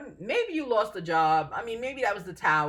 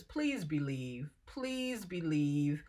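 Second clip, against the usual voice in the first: gentle plea; 4 semitones lower, 6 dB quieter, much slower than usual. Real, not acted. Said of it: "pleading to viewer".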